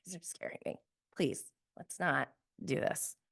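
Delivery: fast breathless whisper; whispered aside. Real, not acted. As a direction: rush it out under your breath. Laughing aside to her viewers.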